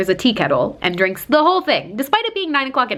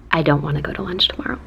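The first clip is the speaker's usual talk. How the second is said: whispered refusal; 5 semitones lower, faster than usual. Retold or imitated. Refusing creepy lunch invite.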